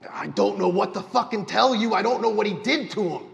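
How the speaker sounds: high, loud, frantic protest; panicked pleading NPC. Acted. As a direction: blurt it out in terror, voice jumping high. NPC panics under threat.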